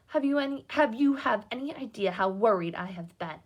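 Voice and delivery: plain reading voice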